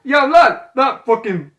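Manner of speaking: hammed-up English accent, loud and high; Butcher. Friend playing Butcher scolding about bullets, bad English accent. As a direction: belt it out in a mock English lad accent. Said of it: "fuck the English accent".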